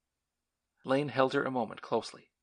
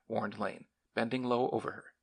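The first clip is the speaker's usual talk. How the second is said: plain reading voice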